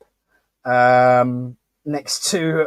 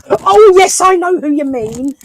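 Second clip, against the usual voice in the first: high, much louder put-on lady's voice; mock posh lady; 11 dB louder than usual. Host mimics the woman he met, high and loud mock lady. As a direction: ham up her knowing reply, pitched high and loud.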